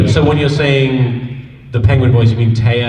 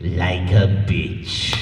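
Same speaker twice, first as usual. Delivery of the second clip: very slow, lowered villain voice; Frieza; 7 semitones lower, 4 dB quieter, much slower than usual. Acted. Fan asks for the line in Frieza voice, drawn-out impression. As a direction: draw each word out slowly and low. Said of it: "in the Frieza voice".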